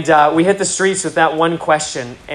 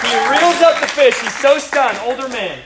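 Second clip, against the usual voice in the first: higher and louder, building to a shout; rising excitement; 6 semitones higher, 5 dB louder than usual. Real, not acted. Preacher telling the crowd the fish miracle, building to a shout. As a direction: let the miracle story build to a triumphant shout.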